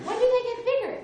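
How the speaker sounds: high, loud, laughing wonder; giddy excitement. Retold or imitated. Asking friends about her first snowflake, high laughing excitement. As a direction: gush over the snowflake like a thrilled kid.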